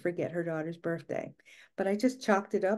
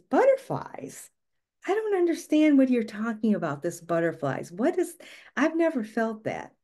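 high puzzled mock voice of her mother, stretching butterflies; mother's baffled reply; 4 semitones higher, 3 dB louder than usual. Mother dismissing butterflies, mock baffled and high. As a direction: play the mother baffled by the word. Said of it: "And my mother one day says".

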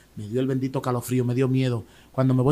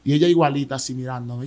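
usual speaking voice

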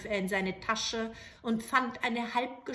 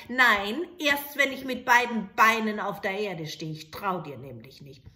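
louder, stubborn refusal; stubborn soldier; 8 dB louder than usual. Acted. Soldier refusing to hand over the light, stubborn. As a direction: dig in, distrustful.

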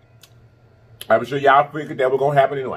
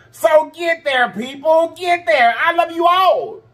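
much higher, slow and punchy encouragement; rousing cheer; slower than usual. Real, not acted. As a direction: drive it home like a cheerleader. Pep talk to fans.

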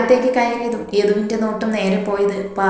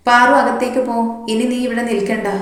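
ordinary delivery

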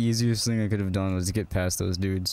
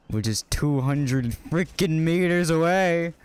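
slow, higher-pitched exasperation; exasperated complaint; 6 semitones higher, slower than usual. Real, not acted. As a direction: drag out the distance in annoyance. Complains about the long run.

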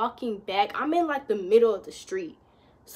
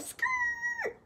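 tire screech imitated high and slow; 5 dB quieter than usual. Shown as a demonstration. Telling friends how the car braked, a high slow tire-screech imitation. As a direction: squeal it out like brakes locking up. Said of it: "that's the sound her car made".

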